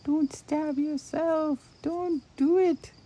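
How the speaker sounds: high, slow playful warning. Real, not acted. Warning herself while needle felting.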